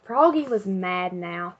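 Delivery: ordinary delivery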